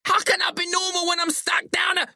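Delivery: very high, panicked shriek. A narrator's reading. Billy protests being stuck down the hole, shrieking. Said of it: "Billy shrieks".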